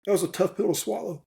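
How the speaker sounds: forcing words out through real emotion; choked up. Real, not acted. Veteran to the host about leaving the Army, choking up. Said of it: "I'm gonna get emotional".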